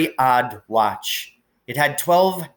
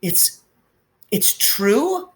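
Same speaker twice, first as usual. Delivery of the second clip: faltering; 10 semitones higher, much slower than usual. A narrator's reading.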